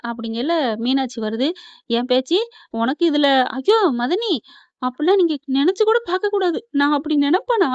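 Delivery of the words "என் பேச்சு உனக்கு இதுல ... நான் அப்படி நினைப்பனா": loud, higher-pitched retold protest; imitated upset protest, raised voice; tearful protest. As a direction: act out a wounded, insistent denial. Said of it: "பேச்சு அழுக".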